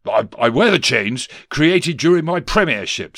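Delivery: loud, high politician's ghost; blustering ghost. Acted. Ghost explains his chains to Scrooge, loudly blustering. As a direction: declare it grandly with a small stumble.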